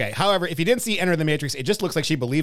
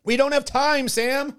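pitched-up mock urgency; hammy urgent line; higher acted urgency; 9 semitones higher, slower than usual. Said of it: "acted line".

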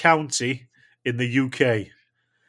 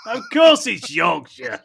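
laughing announcement; 7 semitones higher, slower than usual. Real, not acted.

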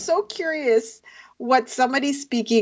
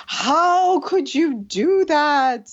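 very slow, higher, drawn-out dismay; hammed-up parental outrage, slowly drawn out; mock reproach; 6 semitones higher, much slower than usual. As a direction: imitate a wounded parent, stretching every word. Voices a parent's shocked reproach.

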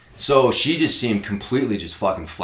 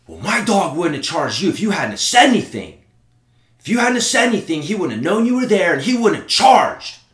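higher-pitched irate woman, building; mock screaming; 6 semitones higher, 4 dB louder than usual. Dog owner blaming him for speaking up, mock screaming. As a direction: mimic her indignant rant, pitch climbing. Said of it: "this lady starts screaming at me".

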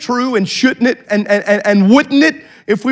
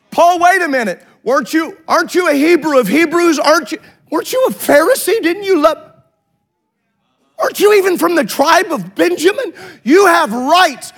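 higher-pitched, mildly incredulous questioning; incredulous challenge; 6 semitones higher than usual. Acted. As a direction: challenge Paul with pressing questions. Preacher challenges Paul with questions.

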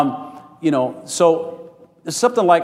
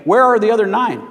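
high, raised-voice demand; pointed question; 5 semitones higher, 4 dB louder than usual. Acted. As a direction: call the question out emphatically. Acts Jesus' pointed question from scripture.

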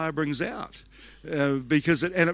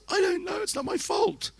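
sheepish innocence. Retold or imitated. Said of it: "I said".

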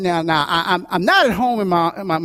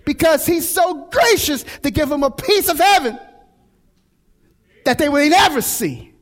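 fervent preaching; 8 semitones higher than usual. Acted. Pastor preaching to his congregation about God's provision, fervent.

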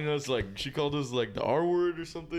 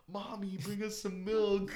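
higher and slow, laughing through it; mock childish plea; 7 semitones higher, 3 dB quieter, slower than usual. Joking request to the friend playing mommy, childish and laughing. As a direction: ask like a little kid.

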